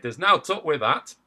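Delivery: mild hearty shout; mild crowd cry. Acted. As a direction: back it up stoutly. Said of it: "they all cried".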